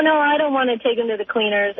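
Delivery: ordinary delivery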